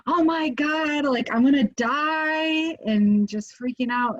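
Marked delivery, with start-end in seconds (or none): 0.1-2.8 panicked. Retold or imitated. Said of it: "instead of being like".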